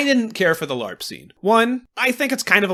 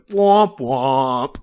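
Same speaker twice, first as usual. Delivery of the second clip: mock womp womp; 2 semitones lower, 5 dB louder than usual. Mocking the others.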